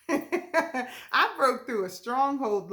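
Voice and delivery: slow, laughing through the words; real joyful laugh while saying it; overjoyed, laughing through the words. Real, not acted.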